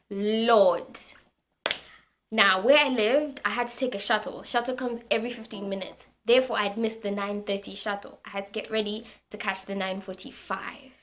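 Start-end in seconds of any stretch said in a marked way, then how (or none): none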